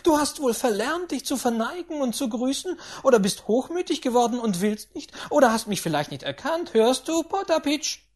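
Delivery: put-on high, loud, domineering old lady berating; a rich old tyrant who brooks no nonsense; imperious grandaunt. A narrator's reading. Grandaunt scolding the tutor and her steward, high and commanding.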